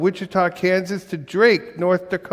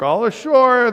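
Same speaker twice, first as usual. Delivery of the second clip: higher, louder dock call; shouted; 8 semitones higher, 5 dB louder than usual. A narrator's reading. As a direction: call everyone off the boat. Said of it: "the men shouted".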